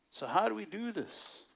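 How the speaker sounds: ordinary talk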